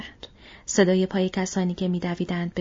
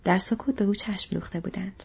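ordinary delivery, nothing marked

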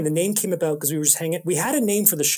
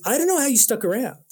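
lightly voiced higher-pitched Paul Constable; a hint of a friend marveling; light imitation of Paul Constable; 9 semitones higher than usual. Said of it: "I sounded just like Paul Constable".

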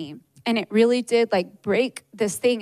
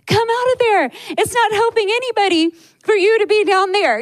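high, louder call from above; voiced loved ones calling; 11 semitones higher, 5 dB louder than usual. Loved ones above the water calling her up, high and bright.

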